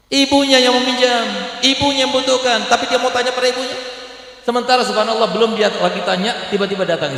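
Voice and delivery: high, loud indignant preaching; indignant fiery sermon; preaching at full force. Acted. Preacher rails at the son.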